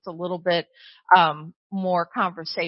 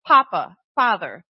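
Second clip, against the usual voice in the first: loud, emphatic cry to a father; heartfelt call, falling on each word; 8 dB louder than usual. Acted. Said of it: "crying out".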